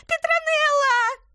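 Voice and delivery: shrieking the name in terror, very high; frightened yell. Acted. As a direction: scream out in fright. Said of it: "завопил он".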